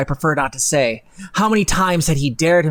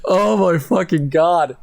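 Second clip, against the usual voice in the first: slow, drawn-out exasperated disbelief; exasperated groan; slower than usual. Real, not acted. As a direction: groan it out like the text is unbearable. Reacting to the fanfic text.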